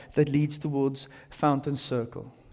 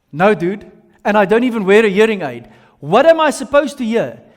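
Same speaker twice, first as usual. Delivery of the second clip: exasperated; 3 semitones higher, 7 dB louder than usual. Acted. Exasperated reply to the birder.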